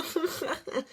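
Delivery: high cackling villain laugh; short mock evil cackle. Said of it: "he's evil, evil laugh".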